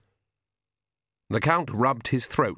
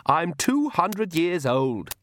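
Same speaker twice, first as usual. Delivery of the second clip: slow, higher-pitched defiance; petulant daughter, pitch raised; defiant; 7 semitones higher, much slower than usual. A narrator's reading. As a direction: answer back to father, drawn out. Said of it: "Don't you adopt that tone with me".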